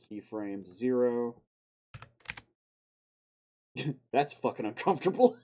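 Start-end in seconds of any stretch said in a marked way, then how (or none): 3.8-5.4 laughing through the words, louder. Real, not acted. Streamer laughing at an awkward playback, words broken by laughter. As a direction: crack up while saying it.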